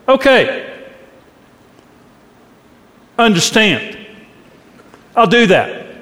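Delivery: a grudging, irritated yes to your spouse; with attitude. Shown as a demonstration. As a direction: say it like you're put out by the request. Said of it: "your spouse is hearing an attitude behind it".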